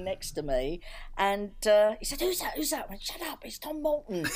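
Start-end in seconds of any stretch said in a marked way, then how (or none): 2.2-2.9 much higher, quick urgent whisper-to-herself curiosity; eager curious husband. Retold or imitated. Husband asks who is calling. 3.0-4.3 high, starstruck shushing; excited hush. Retold or imitated. Hushing husband during the call.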